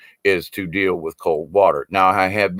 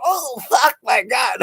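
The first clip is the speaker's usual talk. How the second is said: imitating a high, startled yelp at the cold; mock shocked cry; 14 semitones higher than usual. Campers watching him shower in freezing weather, mock shocked high yelp. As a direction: squeal it like someone horrified by a freezing shower. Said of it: "these guys over there are going".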